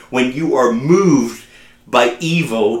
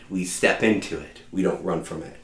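hushed restatement; 8 semitones lower, 11 dB quieter than usual. Acted. Preacher to congregation, softly restating the point. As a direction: drop to a much softer voice.

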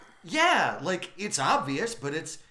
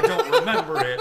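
high, fast laughing burst; 6 dB louder than usual. Retold or imitated. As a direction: crack up through the line. Retold drunk confession.

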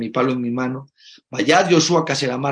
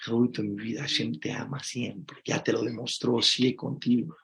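tender reassurance; 2 semitones lower, 7 dB quieter than usual. Acted. Father softly reassuring his worried little son. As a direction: comfort him gently, like a loving father. Soothing a frightened child.

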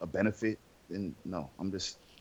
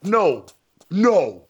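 mock stern parent; 5 semitones higher, 3 dB louder than usual.

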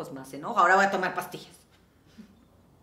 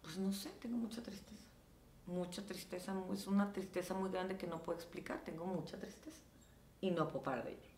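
soft, breathy, tearful whisper; a mock weepy whisper; tearful whimper; 9 dB quieter than usual. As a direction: whisper it like you can't stop crying. Retelling her own postpartum crying.

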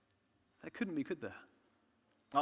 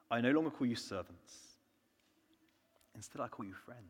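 softer, gentle voice of Jesus; quiet tender quote; 6 semitones lower, 7 dB quieter than usual. Retold or imitated. Jesus telling his followers they are friends, softly. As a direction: speak it gently, as an offer.

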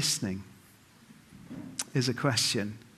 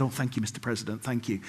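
soft, low absent-minded thanks; mock stunned; 6 semitones lower, 9 dB quieter, faster than usual. Guest thanking the president in the handshake line, mock stunned. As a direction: murmur thanks without taking anything in. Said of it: "they were a bit stunned".

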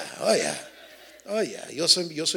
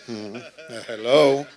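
sassy hello; 2 semitones lower, 9 dB louder, slower than usual. Retold or imitated. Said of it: "La, la hermana esa".

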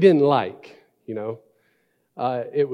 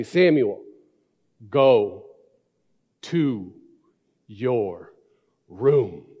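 each word spaced out, low and firm; slow dad voice; 3 semitones lower, much slower than usual. Retold or imitated. Father sending his son to his room, slow and firm. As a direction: lay down the law one word at a time. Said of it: "You know that dad voice, the slow".

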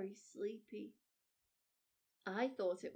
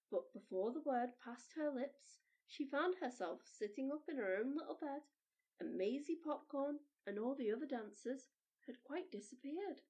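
plain reading voice

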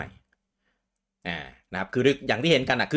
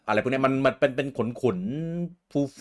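cutesy gushing; 6 semitones higher, much slower than usual. Real, not acted. Gushing over fluffy character.

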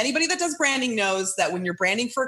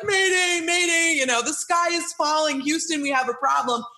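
mock distress call; 6 semitones higher than usual. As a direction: play the panicking pilot. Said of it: "a guy on the plane being like".

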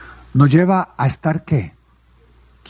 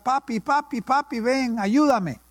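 put-on child calling for dad; 4 semitones higher, 5 dB quieter than usual. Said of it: "empieza a gritar".

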